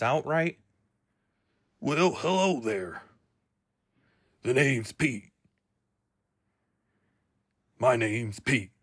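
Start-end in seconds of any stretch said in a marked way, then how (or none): 1.8-8.7 put-on cartoon character voice; Pete. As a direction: introduce yourself as a cartoon character. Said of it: "I'm losing the grasp".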